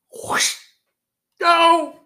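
whoosh then a sudden high yell; whoosh and scream. Shown as a demonstration. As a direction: sell the sound effect. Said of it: "Okay, ready?".

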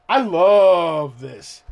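slow, relishing repeat; slow savouring. Real, not acted. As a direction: stretch every word like tasting it.

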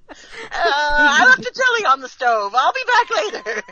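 hammed-up hasty exit line; mock-flustered excuse. Friend inventing a reason to leave, playfully flustered. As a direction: sing out a pretend excuse, rising high.

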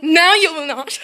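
loud, high flat no; loud refusal. Acted. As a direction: shout her down firmly. Arguing with pesky sister.

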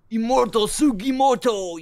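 high, loud, slow boast; anime hero boast. Retold or imitated. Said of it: "he's like".

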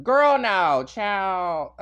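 put-on loud high girl no child; back to vernacular. Shown as a demonstration. Dropping the corporate voice for listeners, loud and high. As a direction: snap out of the work voice loudly. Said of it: "switching back from corporate voice".

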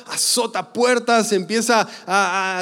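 ordinary talk

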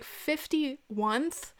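drawn-out disbelieving question; incredulous. Retold or imitated. Mocking the caller's grammar, slow and incredulous. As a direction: stretch it out, rising in disbelief.

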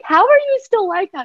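high-pitched incredulous question; imitated amazement. Voicing amazed interns.

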